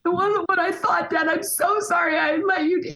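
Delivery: put-on a teen girl bursting into tears as she apologises; crying daughter. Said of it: "her tears, I guarantee, are gonna start".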